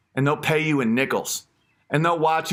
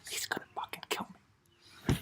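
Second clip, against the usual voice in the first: whisper; 15 dB quieter, faster than usual. Real, not acted. Aside fearing retaliation.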